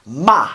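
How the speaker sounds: "ma" loud, high and falling sharply; fourth tone. Shown as a demonstration. Teacher previewing Mandarin tones, forceful falling fourth-tone "ma". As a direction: hit "ma" hard from the top and let it drop. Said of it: "the fourth tone is like bam".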